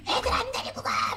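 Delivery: high-pitched, strained retold scream; retold yell. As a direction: yell it as an exasperated mother at breaking point. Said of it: "소리를 지르고".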